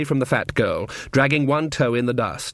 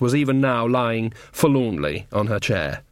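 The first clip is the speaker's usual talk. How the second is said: ordinary delivery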